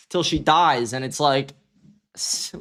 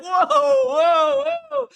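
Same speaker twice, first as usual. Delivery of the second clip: high, laughing shocked whoa; giggling disbelief; 4 dB louder, much slower than usual. Retold or imitated.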